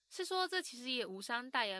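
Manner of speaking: normal talking voice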